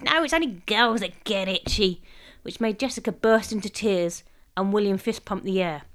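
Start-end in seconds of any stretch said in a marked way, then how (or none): 0.0-2.0 mild gleeful cackle in the voice; cackling. A narrator's reading. Mum teasing the children, cackling. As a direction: say it with a cackly edge. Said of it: "Mum cackled".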